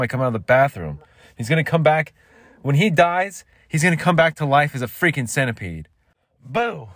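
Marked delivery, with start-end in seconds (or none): 1.4-5.9 raised-voice complaint. Acted. Cursing the prankster. 6.4-6.9 high sudden boo; startling boo. Acted.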